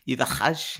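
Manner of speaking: slow French accent, rising at the end. Shown as a demonstration. Host repeating the name for laughs, French accent. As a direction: linger on it with a French r. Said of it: "in a French accent".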